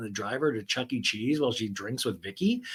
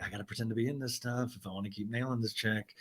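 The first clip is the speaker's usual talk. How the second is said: rushed, much softer inner voice; quiet, quick Ryan; 3 semitones lower, 11 dB quieter, faster than usual. Retold or imitated. Ryan stuck at the therapy session, soft, fast aside. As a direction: mutter it fast under your breath. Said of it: "Ryan's like".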